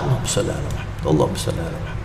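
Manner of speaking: soft, fast, half-whispered murmur of the prayer; a private salawat that needs no loudness; quiet rushed salawat. Shown as a demonstration. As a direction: mumble it under your breath, quickly. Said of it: "Ya pokoknya bersalawat".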